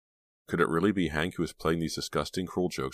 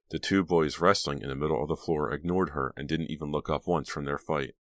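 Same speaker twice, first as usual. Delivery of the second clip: ordinary delivery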